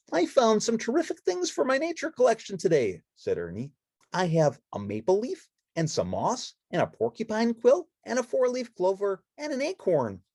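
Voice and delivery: high, bright-eyed puppet chatter; Ernie voice. Acted. Ernie showing friends the autumn woods, high and eager.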